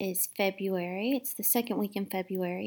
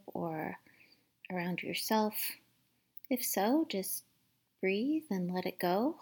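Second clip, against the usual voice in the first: gentle guided meditation voice, partly whispered; calm, hushed meditation guide. Acted.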